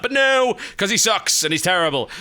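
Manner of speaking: sudden high loud "no"; exasperated outburst. Real, not acted. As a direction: burst out in annoyance. Frustrated outburst at teammate.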